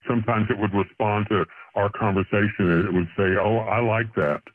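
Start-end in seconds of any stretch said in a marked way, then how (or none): none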